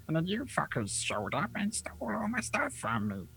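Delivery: hushed goblin whisper. Acted. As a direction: whisper it under the breath.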